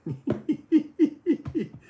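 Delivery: high cartoon dog hyuck laugh; Goofy laugh. Streamer laughs as Goofy, high pitched. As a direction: give a goofy cartoon chuckle. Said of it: "so I got Goofy".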